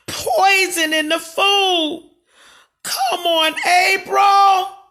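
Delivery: rising to a furious protest; angry shout. Real, not acted. Answering viewers about the poison rumour, shouting angrily.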